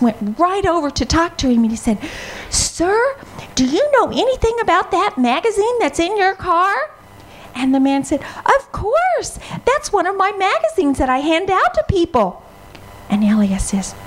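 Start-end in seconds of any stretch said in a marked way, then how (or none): 2.5-6.7 put-on higher, boyish question; eager young boy. Elias asking the man about the magazine, boyish and eager. 8.4-12.4 cheerful stranger. Acted. Stranger answers Elias.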